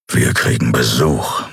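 low growling voice of Keru; growled. A narrator's reading. Keru warns the others of visitors, growling. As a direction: growl it out, deep. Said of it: "knurrte er".

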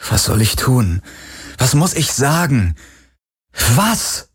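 rising to a high, cracking "Was"; plead, climbing in despair; desperate pleading. Acted. Garland begging the Helix for answers, rising in despair.

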